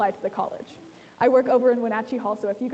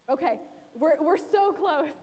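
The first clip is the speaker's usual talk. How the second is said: excited; 5 semitones higher, 3 dB louder, slower than usual. Real, not acted. Audience guessing the word.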